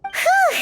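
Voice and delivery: high, bright exhale of relief; relieved whew. Acted. Companion relieved the bad cake lost, high whew. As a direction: blow out the relief.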